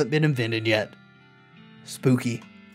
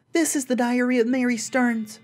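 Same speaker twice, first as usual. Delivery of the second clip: put-on little girl voice; 8 semitones higher than usual. Reading child's diary aloud.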